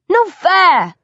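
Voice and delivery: high, loud protest; indignant shout. A narrator's reading. Hunter protesting that the target hides, shouting high. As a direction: shout it out like a sore loser. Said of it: "Essex shouts".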